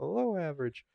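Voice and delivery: high, soft imitation of a friend; Steve impression. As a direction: put on Steve's small, high voice. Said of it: "that's Steve's voice".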